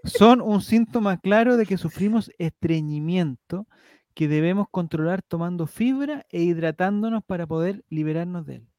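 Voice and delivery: measured article read; reading aloud. Acted. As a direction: read it like an informative text.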